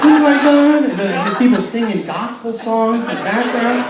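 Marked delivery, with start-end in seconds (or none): 0.0-0.8 louder, higher dismayed gasp; shocked. Retold or imitated. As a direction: react in disbelief. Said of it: "I'm like".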